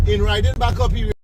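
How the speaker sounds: ordinary delivery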